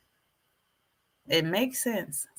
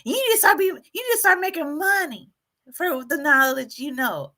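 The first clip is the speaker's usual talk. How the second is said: emailing fan; 7 semitones higher than usual. Retold or imitated. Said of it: "one person was like".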